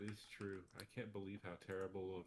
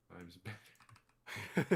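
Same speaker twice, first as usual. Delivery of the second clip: "times better" stretched slow and much louder; drawn-out emphasis; 10 dB louder, much slower than usual. Real, not acted. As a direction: lean hard on "times better", slowly. Boasting about new car mileage.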